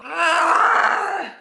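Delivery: loud, high mock-panic wail; overreacting scream. Reader who lost the pen, overreacting scream. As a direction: wail as if it's a catastrophe.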